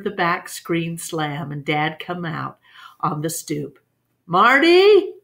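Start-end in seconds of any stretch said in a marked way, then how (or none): 4.2-5.1 calling a name across the yard, much higher and louder. A narrator's reading. Father calling his son from the back stoop, loud call. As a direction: shout for the boy from the porch.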